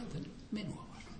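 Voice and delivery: hushed astonished whisper; amazed whisper. Retold or imitated. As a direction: breathe the words out in wonder. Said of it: "Mä olin vähän hämmästynyt".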